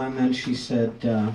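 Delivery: ordinary delivery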